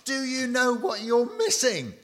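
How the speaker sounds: high, loud, hammed-up protest; mock scream at God. Preacher imagining Paul screaming at God, mock scream. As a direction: yell it as a comically indignant complaint. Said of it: "he would scream at God".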